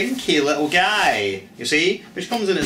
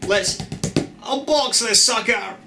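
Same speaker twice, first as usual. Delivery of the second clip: excited; 7 semitones higher than usual. Real, not acted. Hyped announcement.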